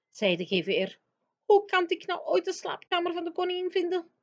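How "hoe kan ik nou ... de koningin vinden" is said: high, puzzled giant voice; a big friendly giant at a loss. Acted. Giant asking Sophie how to find the queen, high and puzzled. Giant despairs at finding room.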